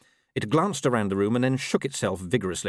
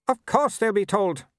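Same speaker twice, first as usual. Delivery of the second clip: high, disdainful aristocratic lady; haughty Baroness; 13 semitones higher, 4 dB louder than usual. A narrator's reading. Mother scorning Vimes to her son, high haughty lady. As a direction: sniff down at everyone like a grand dame.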